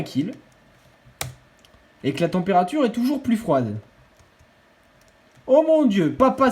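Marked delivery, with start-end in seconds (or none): 5.5-6.5 surpris. Real, not acted. Surprise à l'annonce d'un kill.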